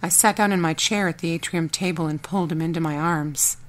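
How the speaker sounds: ordinary delivery, nothing marked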